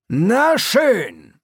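high, loud clipped approval; brisk sergeant. A narrator's reading. Sergeant closing the matter, loud and brisk. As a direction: snap it out, done with it.

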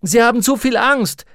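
high, loud, frantic reply; shouted protest. A narrator's reading. Roger answers Lyra about the children, shouting high. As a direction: yell back over the din.

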